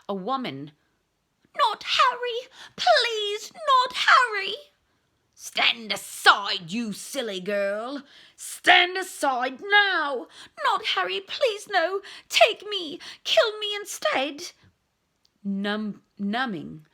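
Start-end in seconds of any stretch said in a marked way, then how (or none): 1.6-4.5 high, loud desperate begging; pleading scream. Acted. Mother begging for her son's life, screaming. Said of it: "Someone was screaming". 5.4-10.3 slow, loud menacing order; cold command. Acted. Killer ordering the mother aside, cold menace. 10.6-14.5 high, loud desperate begging; pleading scream. Acted. Mother begging for her son's life, screaming. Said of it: "Someone was screaming".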